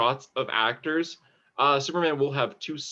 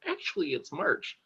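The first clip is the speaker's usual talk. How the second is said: higher, softer nitpicking; mock pedantic commenter; 4 semitones higher, 6 dB quieter than usual. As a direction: mildly mimic an online corrector. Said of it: "a lot of comments being like".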